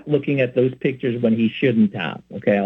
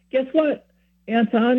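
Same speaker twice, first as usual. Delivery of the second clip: much higher, happy caller; happy news; 9 semitones higher than usual. Retold or imitated. Friend calls back after confession, sounding really happy. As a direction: imitate someone bursting with good news. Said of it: "he kinda sounds really happy".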